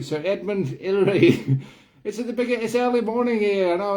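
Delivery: high-pitched slide into Cockney; accidental Cockney. Acted. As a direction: Kiwi impression slipping up into chirpy Cockney. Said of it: "I've gone to Cockney again".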